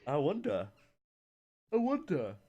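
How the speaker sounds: high-pitched, mock-curious musing; knowing wondering. Real, not acted. Teasing co-caster about what went wrong, knowing wondering.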